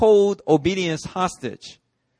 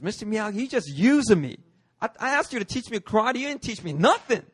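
put-on higher, pleading kid voice; a hint of a whiny teen; whiny Daniel-san; 7 semitones higher than usual. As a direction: play up a street-talking Daniel-san.

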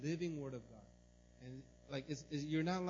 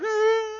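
high, loud hammed-up wail; a comic sobbing howl; mock wailing cry; 18 dB louder than usual. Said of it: "I'm, like, crying".

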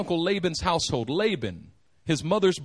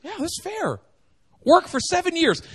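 higher, pleased sly agreement; eager Laban; 8 semitones higher than usual. Retold or imitated. Laban accepting Jacob's seven-year offer, higher and sly. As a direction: sound delighted with the deal. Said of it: "Laban goes".

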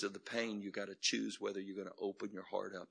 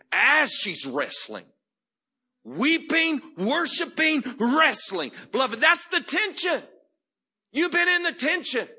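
full-force preaching; 15 dB louder than usual. Acted. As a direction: preach it at the top of your voice.

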